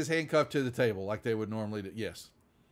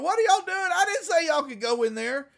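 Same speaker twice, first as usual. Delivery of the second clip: high, loud mock shout; indignant shout; 14 semitones higher, 4 dB louder than usual. Deputy yells at the Marines entering, high indignant shout. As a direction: bark at them like an outraged deputy. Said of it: "and he then turns around".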